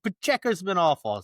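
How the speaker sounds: a hastily reasoned inner voice, acted out; retold panic. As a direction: imitate your own panicky snap judgement. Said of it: "in a panic".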